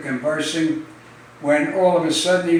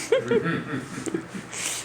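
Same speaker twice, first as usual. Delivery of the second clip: breathy laugh; 8 semitones higher, 9 dB quieter than usual. Real, not acted. Teller laughs after punchline.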